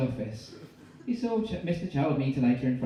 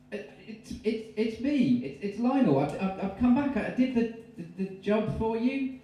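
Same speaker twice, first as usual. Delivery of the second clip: nervous stammer; 10 semitones higher than usual. Acted. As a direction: stammer through the door to Mary. Lionel stammering at door.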